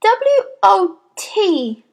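high, slow letter-by-letter spelling; eager schoolboy. A narrator's reading. Boy answers the spelling question too quickly, eager.

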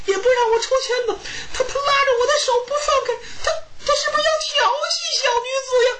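high, wailing false tears that speed up; hammed-up sobbing plea, rushing at the end; fake crying. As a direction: wail to the crowd like a wronged young woman. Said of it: "他哭了".

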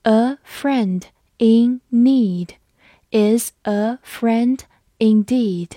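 each word slow and clear; slow reading. Shown as a demonstration. Teacher reading the proverb slowly for students, slow and clear. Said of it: "好，我们慢读一遍。".

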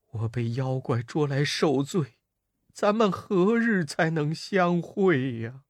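轻声悲叹呼唤徒弟; 绑在树上哀叹的唐僧. Acted. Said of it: "止不住腮边流泪". (伤心哭诉)

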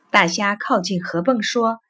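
ordinary delivery

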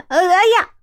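sudden high yelp of pain; cry of pain. Acted. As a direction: yelp as the stick hits his foot. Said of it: "一声惊叫". (一声惊叫)

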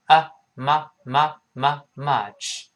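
slow syllable drill, uh, muh, muh, then "much"; much built up. Shown as a demonstration. Teacher drills the vowel for learners, slow muh building to "much". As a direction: repeat the muh slowly before closing into "much". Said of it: "嘴轻轻分开".

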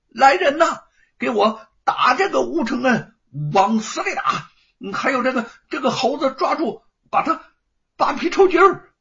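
high, loud, frantic command; a panicked young master shouting for his men; shouted order. Acted. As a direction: scream at the servants to attack. Ordering servants to attack.

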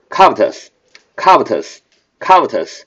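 covetous with the weight on CO, said slowly; stress on the first syllable. Shown as a demonstration. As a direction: lean on the front of covetous, slow and clear. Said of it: "重音在最前面". (重音放在covetous的第一个音节)

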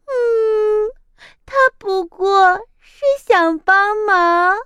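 高声带哭腔; 猫含泪说; tearful cat. Acted. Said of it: "猫流着眼泪说". (猫流着眼泪替鸭子说话)

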